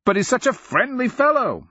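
high-pitched joking protest; lifted, exclaiming woman's voice; mock-earnest cry. A narrator's reading. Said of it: "Mymara cries".

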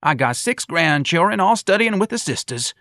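put-on high-pitched old-timer boasting; proud old man. A narrator's reading. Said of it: "the old man said".

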